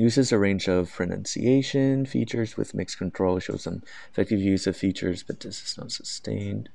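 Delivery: slow, careful rubric reading; very slow, deliberate reading of criteria; reading aloud. Shown as a demonstration. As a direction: read the descriptor out word by word. Rereads band descriptor aloud.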